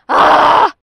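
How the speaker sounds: very loud, high mad scream; reenacted scream. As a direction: break into a sudden loud scream. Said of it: "volvía a gritar".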